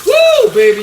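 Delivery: loud, high whoop of delight; excited whoop. Real, not acted. Cook whoops as the egg sizzles loudly. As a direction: cheer the sizzle like a fan.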